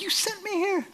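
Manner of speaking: high, soft protest; mild frustration. Acted. Disciple to Jesus who sent him out, frustrated protest. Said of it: "I could be a little frustrated".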